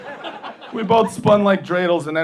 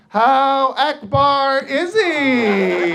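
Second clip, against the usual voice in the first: very slow, higher, stretched question; mock shouted question; 8 semitones higher, much slower than usual. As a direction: drawl each word out, mock challenge. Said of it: "I wanna shout back".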